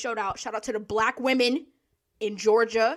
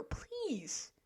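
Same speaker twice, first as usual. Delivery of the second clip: whispered please; 6 semitones higher, 11 dB quieter than usual. Real, not acted. Pleading aside after scolding.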